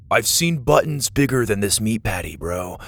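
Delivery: ordinary delivery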